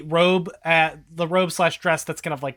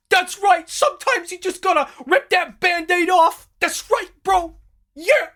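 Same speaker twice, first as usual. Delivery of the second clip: much higher, loud pumped-up bro; hyped bro cheer; 12 semitones higher, 3 dB louder than usual. Acted. Rick Wright cheers on his boss, loud and pumped up. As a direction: yell it like a jock hyping his boss.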